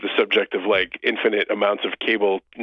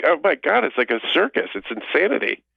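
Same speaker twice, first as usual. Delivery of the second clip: mock exasperated; 5 semitones higher than usual.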